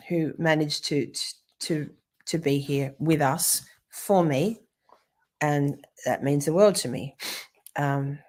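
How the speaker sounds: ordinary talk, nothing marked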